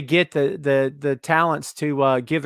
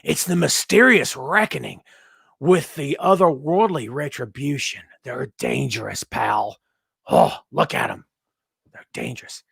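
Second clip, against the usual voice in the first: mock awe. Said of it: "I'm like".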